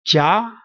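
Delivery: "jia" sliding upward; rising tone. Shown as a demonstration. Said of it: "going up".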